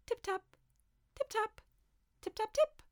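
very high tip-tap imitation; tiny taps, pitched right up; little tappings. A narrator's reading. Storyteller imitating mice answering one another, little high tappings. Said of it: "a chorus of little tappings".

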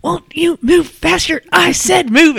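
high barked order, slow; drill sergeant. Retold or imitated. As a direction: yell at the turtle to move. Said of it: "and he's like".

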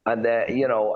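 ordinary talk, nothing marked

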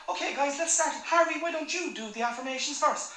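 chirpy dad. Retold or imitated. Dad voiced rallying kids in car.

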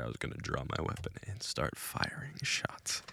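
hushed, unvoiced whisper. Dad confides he almost fired, whispered. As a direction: breathe it like a secret. Quoting dad's confession.

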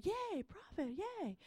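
high, softish pretend yay; half-hearted imitation of delight; mock cheer. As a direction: put on a small, high-pitched cheer. Mimes cheer she didn't feel.

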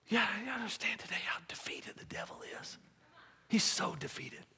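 hushed whisper. Acted. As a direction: lean in and whisper it. Hushed emphasis on defeated devil.

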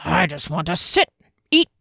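enthusiastic growl. A narrator's reading. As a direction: growl it with eager delight. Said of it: "said with an enthusiastic growl".

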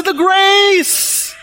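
very high-pitched, drawn-out mock cry; playful outburst. Shown as a demonstration. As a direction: burst out high, playfully breaking the hush. Jokes after "don't say it out loud.

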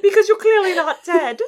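a high, loud quip through laughter; laughing mock reply. Host mocking the father's answer, laughing loud and high. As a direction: crack up while voicing the father.

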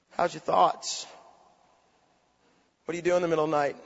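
low, soft questions with long pauses; hushed, pointed preaching. Real, not acted. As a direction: probe the congregation quietly, letting each question hang.

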